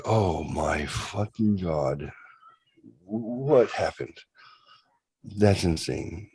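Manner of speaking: slow, stunned disbelief; retold dazed disbelief. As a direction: drag it out, still in shock. Shock after apparition vanished.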